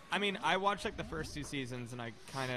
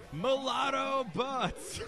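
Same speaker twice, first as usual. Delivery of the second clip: high, slow, drawn-out scream; 12 semitones higher, 4 dB louder, much slower than usual. Retold or imitated. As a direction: belt it like a wild salsa singer. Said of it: "with this guy screaming".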